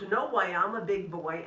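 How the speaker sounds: lightly voiced proud boy; mock kid bravado; proud little boy. Child voiced in story.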